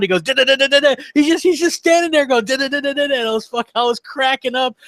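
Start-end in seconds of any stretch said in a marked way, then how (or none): 0.2-0.9 high, loud rapid-fire gun noise; mock machine gun noise; imitated gunfire sound. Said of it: "he does the sound". 2.5-3.1 mock machine gun noise; imitated gunfire sound. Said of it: "he does the sound".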